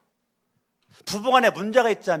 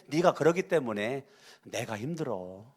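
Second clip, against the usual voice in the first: softer, lower, calm explaining; gentle I-message; 4 semitones lower, 7 dB quieter than usual. Shown as a demonstration. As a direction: say it softly, owning your feelings. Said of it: "근데 아이 메시지". (부드럽고 낮게 자기 감정을 말하는 아이 메시지)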